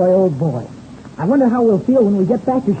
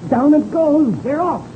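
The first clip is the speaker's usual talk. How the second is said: high, urgent cheering as the flag drops; excited cheer; 8 semitones higher, slower than usual. Acted. Crewman cheering their plane off at the race start, excited. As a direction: urge the plane on at the starting line.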